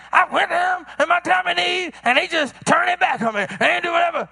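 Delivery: playing the wounded complainer; a hint of a resentful friend; mock aggrieved friend. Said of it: "Then they get mad. Then they say".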